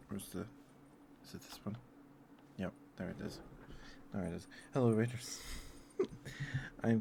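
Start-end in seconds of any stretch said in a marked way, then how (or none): 0.1-4.5 half-whispered muttering to self. Real, not acted. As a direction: whisper it while searching. Searching softly, whispered.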